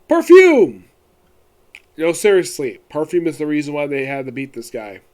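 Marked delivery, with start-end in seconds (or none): 0.1-0.8 shouted out; emphatic shout. Real, not acted. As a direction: blurt it out loud. Punchline reveal, shouted.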